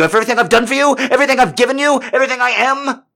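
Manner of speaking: screaming furious abuse, pitch way up; furious tirade. Shown as a demonstration. Showing the listener his rage episode, screaming. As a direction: yell every insult at full rage. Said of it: "through this voice".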